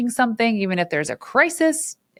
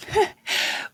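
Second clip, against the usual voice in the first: breathy laugh. Real, not acted. Laughs at own joke.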